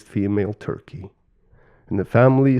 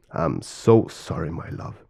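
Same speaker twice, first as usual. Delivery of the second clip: tender apology; 5 semitones lower, 4 dB quieter than usual. Acted. Father to his beloved mother creator, tender remorse.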